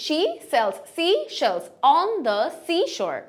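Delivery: slow, word by word tongue-twister demo; measured pronunciation drill; careful tongue twister. Shown as a demonstration. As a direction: separate each s and sh slowly. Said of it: "এভাবে উচ্চারণ করবেন".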